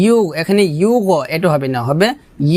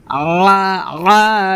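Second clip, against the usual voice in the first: ordinary talk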